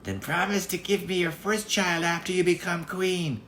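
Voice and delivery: a sly little goblin bargaining; impish little man. Acted. Little man bargains with the girl, high and impish. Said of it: "said the little man".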